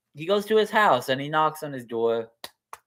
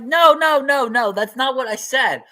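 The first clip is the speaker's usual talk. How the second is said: exasperated student protest; 12 semitones higher, 8 dB louder than usual. Acted.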